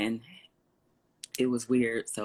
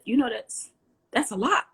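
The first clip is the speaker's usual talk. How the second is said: impressed dad; 11 semitones higher, 3 dB louder than usual. Retold or imitated. Dad impressed by staff size.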